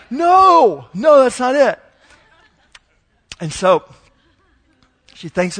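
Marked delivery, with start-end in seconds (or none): 0.1-1.8 much higher and louder, laughing no-no; exasperated brush-off. Retold or imitated. Dad imitating his six-year-old shooing off her little sister, high and loud. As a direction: swat it away like a fly.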